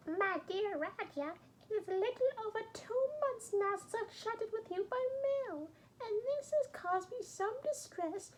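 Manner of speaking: a loving mother close to tears, pitched well up; mother's voice. Acted. Mother writing to her son Raja, high tearful voice. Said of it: "that was clickbait".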